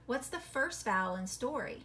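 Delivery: usual speaking voice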